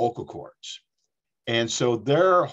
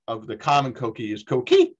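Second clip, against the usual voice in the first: normal talking voice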